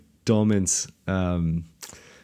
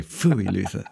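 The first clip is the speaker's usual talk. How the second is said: quoting Luther; slower than usual. Retold or imitated. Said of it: "He always used to say".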